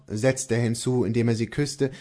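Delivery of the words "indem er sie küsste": ordinary delivery, nothing marked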